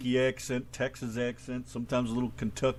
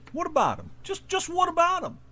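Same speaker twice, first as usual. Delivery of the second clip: insistent; 5 dB louder than usual. Real, not acted. Pressing the sports question.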